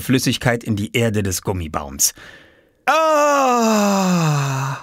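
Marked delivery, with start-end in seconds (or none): 2.8-4.8 long, high groan sliding down; relieved groan. A narrator's reading. Oskar finally relieving himself, groaning with relief. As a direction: let out a long sigh of release. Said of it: "stöhnte Oskar".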